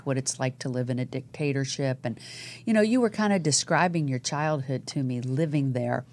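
normal talking voice

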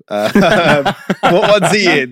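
high, loud, helpless laughing; can't get the question out for laughing. Real, not acted. Asking friends about the actor's films, laughing. Cracking up over his mistake.

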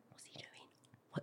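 slow, barely audible whisper; imitated hushed question; discreet whisper. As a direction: whisper it like a baffled guest. Friends ask discreetly about the child.

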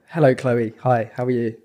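put-on posh English accent. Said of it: "do your poshest accent".

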